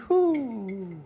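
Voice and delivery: high whoo of delight; joyful whoop. Real, not acted. Cook tastes his sauce, high excited whoop. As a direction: let out a happy whoop.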